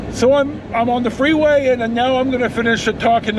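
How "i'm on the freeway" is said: ordinary talk, nothing marked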